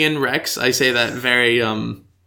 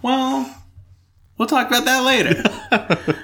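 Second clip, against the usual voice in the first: high-pitched, breaking into laughter; amused deflection; 11 semitones higher, slower than usual. Real, not acted. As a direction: squeak it out through a laugh. Laughing high reply to teasing.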